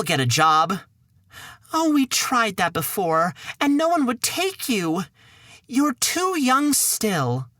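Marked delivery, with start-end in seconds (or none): 1.7-7.5 higher fretful mother voice; the worried mum again; high-pitched mother. Acted.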